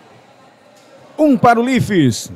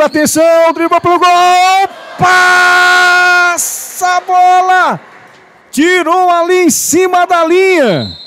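excited play-by-play; 7 semitones higher, slower than usual. Real, not acted. Near goal, rising play-by-play.

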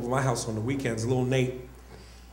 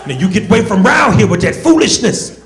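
louder, higher, put-on old plantation voice; slave from Roots; 4 semitones higher, 8 dB louder than usual. Scared boy begging his friend to leave, hammed-up Roots slave voice. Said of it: "talking to him like a slave from Roots".

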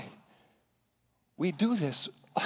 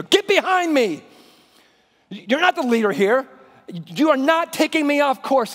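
raised, higher voice of Jesus rebuking Peter; stern rebuke; 6 semitones higher, 7 dB louder than usual. Acted. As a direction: command him to step back, firmly. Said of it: "he says to Peter".

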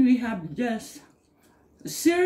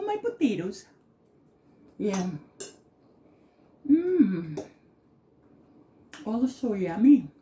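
chewing while speaking; talking between bites; mouth full; 6 semitones lower, 4 dB quieter than usual. Real, not acted. Seen 3: Chewing with closed mouth during "Mm", food forked to mouth.

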